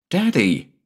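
put-on excited little boy. A narrator's reading.

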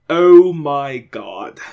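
slow, loud, breathy gasp of dismay; slow breathy cry of alarm; breathy exclaim. Acted. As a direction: breathe it out in a panic. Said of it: "the same breathy voice exclaimed".

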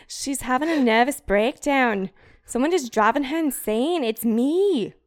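hammed-up British diarist, building drama; British accent. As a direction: declaim it in a put-on British accent. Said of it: "I did it in a British accent".

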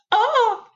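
high, sudden holler; mock yell. New Yorker facing a coyote, high mock yell. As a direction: cry out high as if warding off an animal. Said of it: "I'll say, Yo, I'm from New York".

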